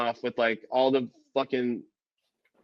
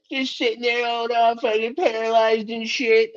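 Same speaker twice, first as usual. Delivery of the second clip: higher, mocking put-on voice; mocking the paralyzed governors; 8 semitones higher than usual. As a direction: sneer it in a silly high voice.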